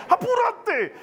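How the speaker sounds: very high, exasperated call to hurry; mock impatient yell. Spouse hurrying the other along before church, impatient. Acted hurrying in family quarrel.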